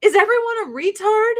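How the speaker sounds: high, disbelieving outburst; high incredulous rant. Retold or imitated. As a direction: act stunned by everyone. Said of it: "I'm like".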